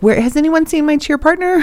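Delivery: mock lost cheerleader. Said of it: "be like".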